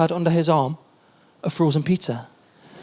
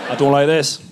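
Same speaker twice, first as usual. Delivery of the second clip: a grumpy swan muttering its complaint; low swan voice; 6 semitones lower than usual. Retold or imitated. Comedian voicing the grabbed swan, low and put-out. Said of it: "the swan just went".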